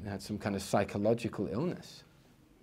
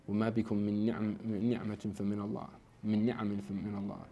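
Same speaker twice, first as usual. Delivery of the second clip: Quran recitation; 5 dB quieter, much faster than usual. Real, not acted.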